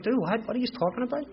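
high-pitched baffled comeback; incredulous. Retold or imitated. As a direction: act out a disbelieving "what are you on about". Retelling his reaction to psychiatrists' questioning.

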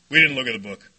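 put-on low, matter-of-fact old-timer answer; low-key sponsor. Sponsor answers the questioning newcomer, low and matter-of-fact.

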